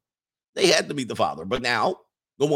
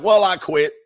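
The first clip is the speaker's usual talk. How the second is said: louder, higher mock huff; mock quitting; 5 semitones higher, 8 dB louder than usual. Voicing a quitter, a loud mock huff. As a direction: throw it down like you're done.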